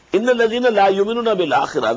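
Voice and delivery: melodic, measured tajweed chant; Quran recitation. Acted. Teacher recites the verse to his students, chanted tajweed. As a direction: intone the verse as in prayer.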